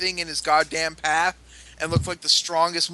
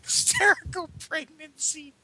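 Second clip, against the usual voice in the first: incredulous echo; 10 semitones higher than usual. Real, not acted.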